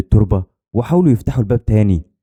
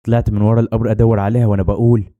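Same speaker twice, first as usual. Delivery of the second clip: usual speaking voice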